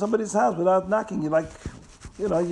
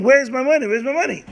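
high, louder panicked demand; acted scream, pitched up; mock screaming; 8 semitones higher, 4 dB louder, faster than usual. A narrator's reading. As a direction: cry it out in mock alarm. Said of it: "start screaming".

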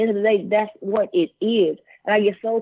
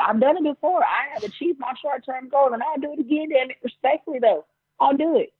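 megalomaniac rant; 9 semitones higher than usual. Acted. Persona boast, pitch up.

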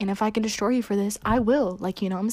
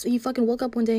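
usual speaking voice

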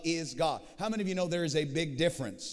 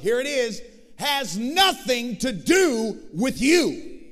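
loud, slow, higher preacher's proclamation; rising, forceful sermon delivery; full-force preaching; 6 semitones higher, 6 dB louder, slower than usual. Acted. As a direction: proclaim it slowly to the whole church. Preaching surge on God's love.

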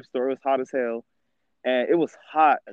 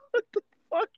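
laughing what the fuck; 6 dB quieter than usual. Retold or imitated. Laughing outburst in retold quote.

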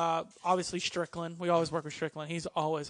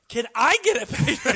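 louder, higher excited repeat of "Can I get a picture?"; excited echo; 5 semitones higher, 8 dB louder than usual. Retold or imitated. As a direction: echo the request back, thrilled. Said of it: "And Omega's like".

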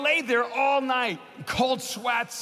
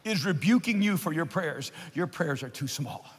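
raised, ringing preacher voice; preaching at full force; 6 semitones higher than usual. Acted. Preacher urging the congregation, in full sermon force.

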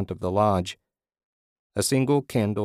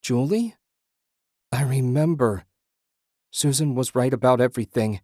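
mild distressed cry, pitched up; understated anguish, voice raised in pitch; 4 semitones higher than usual. A narrator's reading. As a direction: call out in pained recognition. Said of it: "Ted cried harshly".

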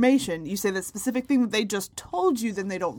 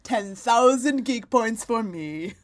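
gloating; 2 semitones higher, 5 dB louder, much slower than usual. Acted. Gloating about geek win.

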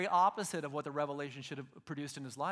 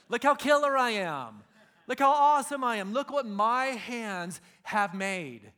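loud, high mock bragging; hammed-up boast in the hanging gardens; boastful king; 3 semitones higher, 6 dB louder than usual. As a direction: puff up like a vain king.

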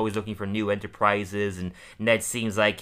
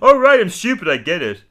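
high, much louder exasperated put-on voice; Homer giving in; 8 semitones higher, 10 dB louder than usual. Homer answers the laughing family, loud high mock exasperation. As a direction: blurt it like a fed-up Homer. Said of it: "And Homer's like".

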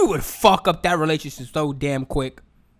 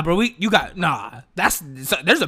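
ordinary delivery

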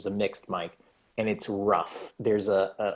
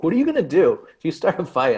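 high, loud, alarmed protest; 8 semitones higher, 7 dB louder, faster than usual. Retold or imitated. Telling Tom he won't help in a fight, high alarmed yelp. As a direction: yelp it out in a panic. Panicked reply to Tom's plan.

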